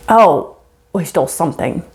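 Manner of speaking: ordinary talk, nothing marked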